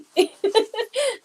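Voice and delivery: a high, embarrassed giggle; embarrassed laugh. Real, not acted. Laughing at her own tears in front of viewers.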